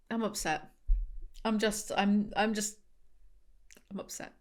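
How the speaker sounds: small, soft, put-on hurt voice; over-dramatic sulk, softly halting; mock upset, dramatic. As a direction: play at being upset, trailing into quiet. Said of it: "I'm just being really dramatic".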